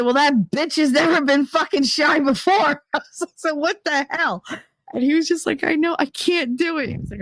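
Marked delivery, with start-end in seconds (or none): 0.0-2.8 indignant outrage. Retold or imitated. Snapping back at the doctor about her cervix, outraged. 3.4-4.4 mock outrage. Indignant retort. 5.6-6.9 mock whiny doctor. Doctor whining.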